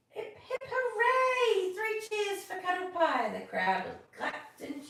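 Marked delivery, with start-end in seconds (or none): 0.1-3.4 high, loud cheer. Acted. The crowd cheering for Cuddle Pie, loud and high.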